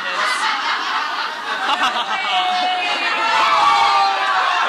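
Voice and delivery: loud high helpless laugh; real giggling fit; burst of laughter. Real, not acted. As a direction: crack up at her own words. Laughs at her own line.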